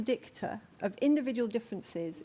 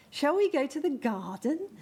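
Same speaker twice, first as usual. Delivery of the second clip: put-on higher, louder sing-song baby talk on "Shall we go to the garden?"; 5 semitones higher, 4 dB louder than usual. Shown as a demonstration. Parent asking a baby to go outside, in rhythmic higher baby talk. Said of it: "we really emphasize rhythm in baby talk".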